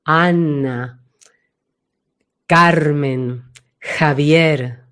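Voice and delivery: low, spaced "Ana", "Carmen", "Javier", resonating at the back; each of "Ana, Carmen, Javier" dropped into depth; deep back-placed names. Shown as a demonstration. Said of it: "Tienen mucha profundidad".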